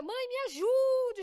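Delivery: put-on high, drawn-out plea of a hurting child; high childish cry for help; child pleading. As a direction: beg like a little kid in pain. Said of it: "Caía".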